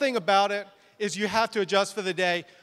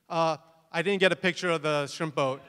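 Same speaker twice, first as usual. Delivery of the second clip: usual speaking voice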